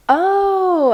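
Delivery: high, loud startled oh; surprised oh. Retold or imitated. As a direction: realize it suddenly.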